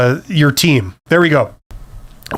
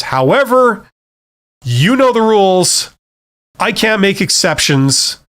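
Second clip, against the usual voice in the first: higher, drawn-out mock rule-enforcing; firm manager; 6 semitones higher, slower than usual. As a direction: play a manager laying down the rules, slowly. Acting a manager standing firm.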